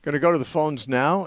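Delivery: normal talking voice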